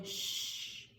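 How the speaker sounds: soft unvoiced hush; soothing shh. A narrator's reading. Willie Mae rocking Sistine like a baby, hushing softly. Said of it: "rocking her and saying".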